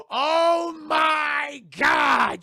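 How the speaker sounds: drawn-out, very loud, high cry; hammed-up horror at a big reveal; shocked cry. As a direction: wail it slowly in theatrical shock.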